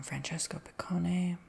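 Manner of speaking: ordinary talk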